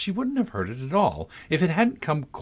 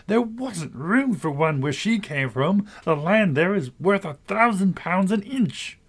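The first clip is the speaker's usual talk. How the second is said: chorus of voices; 9 semitones higher than usual. A narrator's reading. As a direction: call it out like a chattering crowd.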